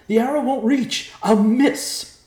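high, loud frantic yell. A narrator's reading. Thomas fears his arrow will miss, yelling. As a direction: shout it back in a panic. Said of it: "Thomas yelled".

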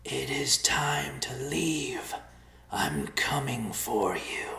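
slow, menacing whispered voice; sinister whisper. A narrator's reading. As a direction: whisper a cold threat slowly. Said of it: "The voice returned".

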